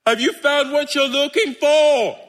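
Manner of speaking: high, sustained holler; calling out. Acted. Jesus questioning searching disciples from shore, calling out. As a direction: holler the question across the water.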